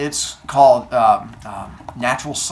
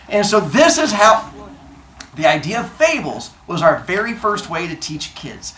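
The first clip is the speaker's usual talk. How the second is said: ordinary delivery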